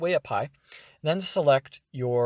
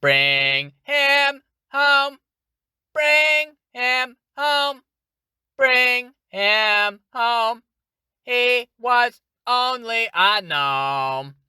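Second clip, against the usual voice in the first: high, slow, melodic robot chant; sing-song robot; 10 semitones higher, 7 dB louder, much slower than usual. Shown as a demonstration. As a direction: sing each word on a new note. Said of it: "you're kind of singing as you talk".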